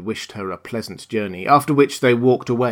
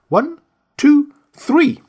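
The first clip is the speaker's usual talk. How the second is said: slow, solemn ritual count, much higher and louder; 8 dB louder, much slower than usual. Acted. Old witch counts for the magic slate, solemn raised incantation. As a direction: count like casting a spell. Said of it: "in a solemn voice".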